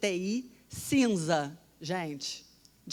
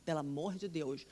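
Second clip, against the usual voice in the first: scared prayer; 4 semitones lower, 8 dB quieter than usual. Retold or imitated. Alone in the grey ICU, praying to God in fear, softly. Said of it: "eu tava com medo danado".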